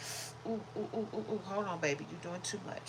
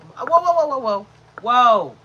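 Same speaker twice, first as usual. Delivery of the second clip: loud, raised string of whoas; real alarmed shout; 4 semitones higher, 17 dB louder than usual. Real, not acted. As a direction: call out sharply to halt the dog. Calling out to stop the dog.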